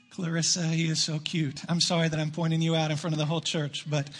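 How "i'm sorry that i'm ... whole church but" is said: normal talking voice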